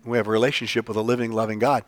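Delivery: ordinary delivery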